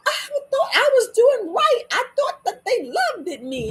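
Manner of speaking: high-pitched, hammed-up protest; mock dismay. Acting out someone learning they were wrong, dismayed. Acts out hurt self-realization.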